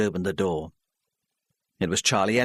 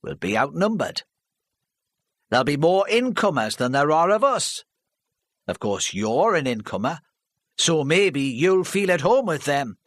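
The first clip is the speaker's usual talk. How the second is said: louder, higher scornful local man; scornful villager; 6 semitones higher, 3 dB louder than usual. Acted. Charlie scoffing at Mrs Parry before the hall, scornful. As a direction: scoff at her plan.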